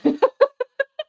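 bursting into a high giggle; high laugh. Real, not acted. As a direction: giggle at yourself. Laughs at own panic.

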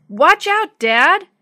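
loud, high alarmed yell; sudden warning yell. A narrator's reading. Girl warning her dad at the wheel, sudden yell. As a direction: yell an urgent warning to the driver. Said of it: "suddenly yelled".

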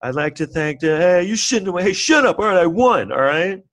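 hammed-up rattled Oscar winner; heckled winner. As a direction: snap back at hecklers mid-speech. Winner's speech heckled, snapping back.

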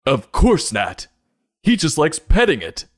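hammed-up indignation; indignant loud retort. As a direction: defend the dog with mock outrage. Acted quarrel over the dog.

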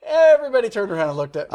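laughing it out, high and loud; bursting into laughter as he tells it. Real, not acted. Laughing to his co-host about screaming in the movie.

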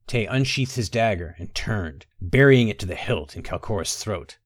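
ordinary delivery

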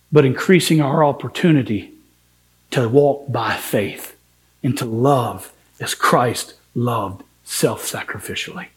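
ordinary delivery

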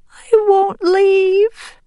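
high, slow tearful refusal. A narrator's reading. Green Top refusing to leave his mother, tearful. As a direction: wail it slowly like an upset child. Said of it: "Green Top said tearfully".

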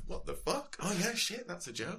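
high, startled sudden realization; imitating your own baffled self on stage; mock surprise. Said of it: "And you're like".

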